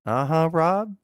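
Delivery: flat, lightly voiced uh-huh; bored neighbor. Neighbors politely humoring Rob with a bored uh-huh. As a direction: humor him without interest. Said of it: "they're probably sitting there".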